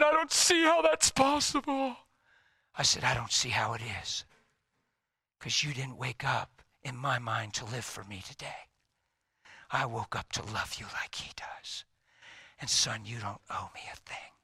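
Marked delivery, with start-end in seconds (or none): 0.0-2.1 high, strained crying son's voice; imitated tearful disbelief. Crying son cannot believe dad's forgiveness, imitated tearful voice. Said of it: "He's just crying". 3.0-14.3 low, softening fatherly voice; tender reassurance. Retold or imitated. Father forgives his crying son, retold tender reassurance.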